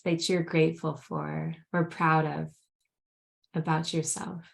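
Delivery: grounding meditation. Acted. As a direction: gently guide them into the present moment. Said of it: "would you lead us in a grounding meditation".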